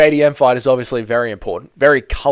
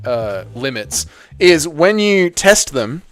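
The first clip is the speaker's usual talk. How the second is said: normal talking voice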